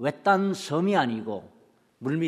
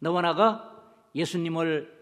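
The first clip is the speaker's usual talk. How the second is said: ordinary delivery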